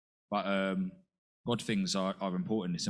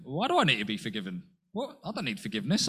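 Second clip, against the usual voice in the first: normal talking voice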